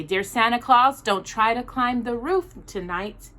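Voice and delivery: ordinary delivery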